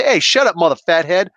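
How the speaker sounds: high, loud mock retort; mock Jersey tough guy. Jersey guy telling off a loudmouth, brash mock retort. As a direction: play at a brash Jersey comeback. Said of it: "he's not from Jersey".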